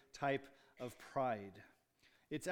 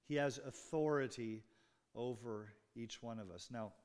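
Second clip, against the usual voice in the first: usual speaking voice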